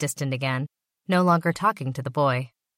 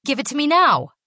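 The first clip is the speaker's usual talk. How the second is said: much higher, louder commanding shout; shouted demand; 10 semitones higher, 6 dB louder than usual. A narrator's reading. Basil shouting at the secretary for his drug bag. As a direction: bark the order at her. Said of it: "Put off".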